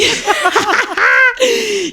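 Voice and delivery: high, loud burst of laughter; big laugh. Real, not acted. Storyteller laughing at an owl joke, high and loud. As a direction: crack up loudly at the joke.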